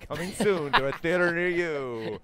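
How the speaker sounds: loud, booming trailer sign-off; movie trailer voice. Pretend. Host finishing the contestant's pitch, big movie trailer voice. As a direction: belt out a hammed-up cinema announcement, last word stretched. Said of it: "the movie trailer voice".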